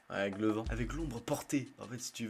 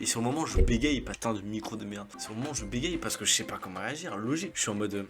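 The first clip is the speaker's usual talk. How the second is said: ordinary talk, nothing marked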